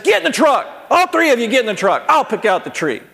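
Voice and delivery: angry father ordering sons into the truck; upset dad. Retold or imitated. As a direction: snap at the boys to get in. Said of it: "I got so upset".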